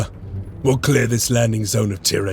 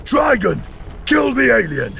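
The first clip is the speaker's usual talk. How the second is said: raised high, shouted war cry; battle cry; 12 semitones higher than usual. Acted. Space marine rallying his squad, shouted battle cry. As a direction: bellow it over the gunfire.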